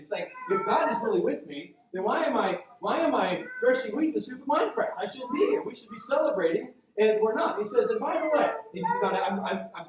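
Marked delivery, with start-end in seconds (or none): 0.5-7.6 higher-pitched imitation of exasperation; acted-out frustrated protest; really frustrated. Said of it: "He's, he's really frustrated". 7.9-8.5 high, indignant interjection in imitation; mock-aggrieved, pitched up; frustrated. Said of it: "He's, he's really frustrated".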